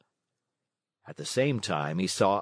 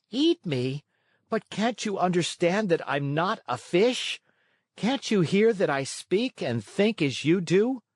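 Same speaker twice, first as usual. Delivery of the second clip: a frightened marionette, higher pitch; high, alarmed puppet pleading; 9 semitones higher than usual. A narrator's reading. Pinocchio begging the fisherman not to eat him, high and alarmed. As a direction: plead to be understood.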